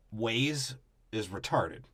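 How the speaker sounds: ordinary delivery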